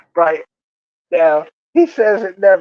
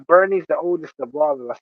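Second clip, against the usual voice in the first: ordinary talk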